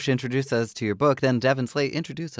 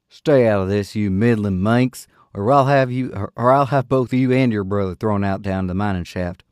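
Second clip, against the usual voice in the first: put-on louder, menacing drunken threat; bully her into silence; angry threat; 6 dB louder than usual. Armed drunkard threatens woman.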